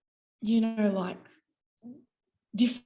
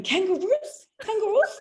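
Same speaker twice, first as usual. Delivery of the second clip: higher, louder Kangaroos? rising each time; hammed-up customs officer; 11 semitones higher, 5 dB louder than usual. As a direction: play the officer finally getting it, overacted. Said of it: "there's my acting training".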